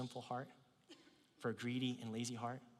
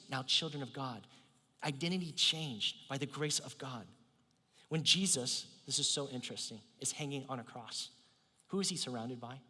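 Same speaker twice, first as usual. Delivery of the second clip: ordinary delivery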